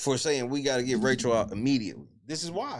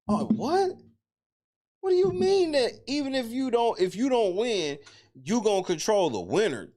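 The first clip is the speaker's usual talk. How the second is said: shocked disbelief; 7 semitones higher than usual. Real, not acted. Reacts to Rachel's claim.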